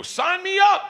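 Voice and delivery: very high, loud burst of enthusiasm; eager yell. Retold or imitated. Customer offered a big gun, hammed-up excited yell. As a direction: blurt it like a thrilled buyer, hammed-up.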